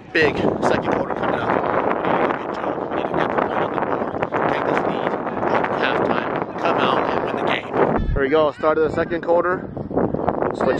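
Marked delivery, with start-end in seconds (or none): 0.1-7.7 hushed whisper, no voice. Real, not acted. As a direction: whisper it like a secret pep talk. Hushed pep talk before quarter.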